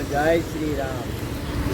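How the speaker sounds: slow prompt. Real, not acted. Prompting child again.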